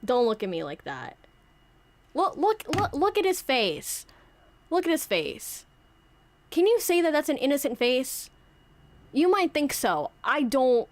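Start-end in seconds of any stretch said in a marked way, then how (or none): 2.1-10.9 mock indignation. Santa mock-accusing the puppet.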